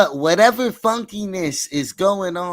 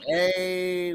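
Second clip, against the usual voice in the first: normal talking voice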